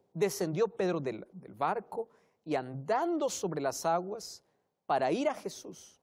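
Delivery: usual speaking voice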